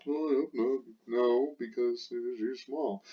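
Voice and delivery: lightly voiced student answering no; expected answer. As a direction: play the student giving the wanted answer. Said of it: "So I want the answer to be no".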